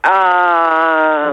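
saying ah with mouth wide open. Real, not acted. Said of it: "Ouvrez grand la bouche et dites-moi A".

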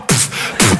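short unvoiced spitting puffs; spitting sawdust. Spitting out sawdust in the workshop, soft unvoiced puffs. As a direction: spit out sawdust in quiet puffs.

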